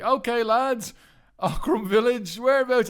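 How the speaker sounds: lightly voiced confident driver; breezy driver. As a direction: cheerfully ask where.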